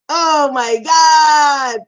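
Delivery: slow, loud pained cry; real anguished wail. Real, not acted. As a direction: cry out as the bone goes back. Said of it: "pain at arm reset".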